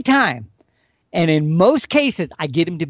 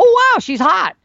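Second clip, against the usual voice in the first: much higher and louder, wowed; a big mock gasp of delight; impressed wow; 13 semitones higher, 4 dB louder than usual. Bull's imagined reaction to cow.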